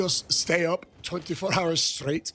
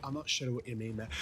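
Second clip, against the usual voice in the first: ordinary talk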